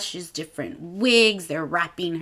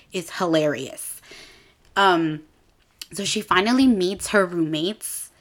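ordinary talk

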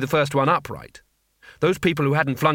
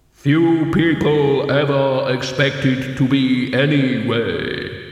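slow, loud, echoing pronouncement; measured otherworldly voice, dropping at the end; voice of Death; 5 dB louder, slower than usual. A narrator's reading. Death answers the newly dead teacher, solemn.

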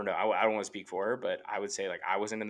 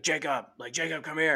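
raised voice calling his name from downstairs; 8 semitones higher, 5 dB louder than usual. Retold or imitated. Brother calling from downstairs, loud call. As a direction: call up the stairs to get his attention.

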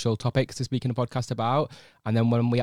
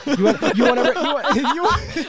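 laughing high squeaky tease; giggling through the words; 7 dB louder than usual. Real, not acted. Teasing mid-laugh, high.

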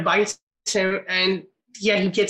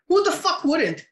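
incredulous; 9 semitones higher than usual. Real, not acted. Incredulous rant about getting cocky.

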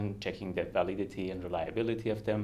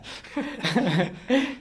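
high, loud laugh; 5 dB louder than usual. Real, not acted. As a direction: keep laughing at the joke. Laughing at shared joke.

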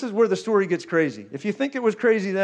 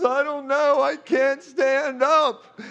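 high, drawn-out mock helplessness; hammed-up helpless reply, pitched up; imitating a collapsing interpreter; 5 semitones higher, slower than usual. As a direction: play someone struggling to stay upright, last words stretched. Acts out interpreter losing his footing.

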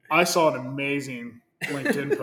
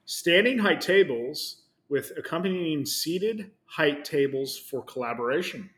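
corporate announcement voice; 3 semitones higher, 4 dB louder than usual. Acted. Reading the corporate post aloud.

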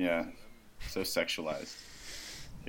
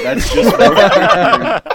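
laughing; 13 semitones higher, 10 dB louder, much slower than usual. Retold or imitated. Laughing through retold quote.

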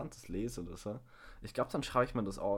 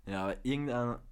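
ordinary delivery

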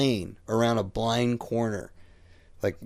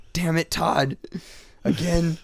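higher-pitched mock scolding; retold exasperation, voice raised in pitch; exasperated partner; 9 semitones higher, slower than usual. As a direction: imitate a fed-up partner, pitched up.

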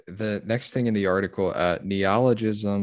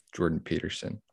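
ordinary talk, nothing marked

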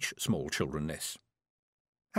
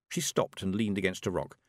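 usual speaking voice

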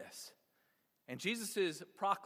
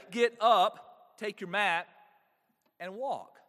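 commanding; 3 semitones higher, 8 dB louder, slower than usual. Acted. Jesus commanding the paralytic.

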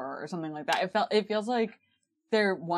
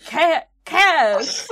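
loud, higher call of Cass; mock Dean shout; 9 semitones higher, 10 dB louder than usual. Warming up the Dean impression, a loud, higher call of Cass. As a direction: yell Cass as a warm-up for Dean. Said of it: "get into character with that".

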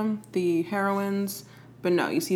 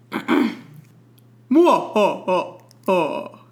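villain's mwah ho ho ho; evil laugh; 6 dB louder, much slower than usual. Pretend. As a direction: cackle like a cartoon villain. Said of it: "Give me your best evil laugh".

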